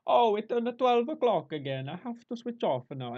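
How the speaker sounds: high, loud comic Italian worker; Italian accent. Acted. Lazy Italian worker putting off an order, mock Italian accent. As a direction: play a hammed-up Italian slacker. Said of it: "I say Italian".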